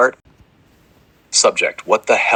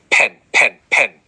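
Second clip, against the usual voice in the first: loud flat robot chant; 2 semitones higher, 5 dB louder, slower than usual. Acted. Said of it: "in a loud, flat, soulless, robotic voice".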